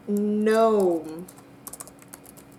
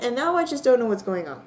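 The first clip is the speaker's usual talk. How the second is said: ordinary talk, nothing marked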